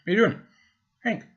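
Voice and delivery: high, sing-song question to a pet; talking to the dog. Real, not acted. Asking the dog Hank.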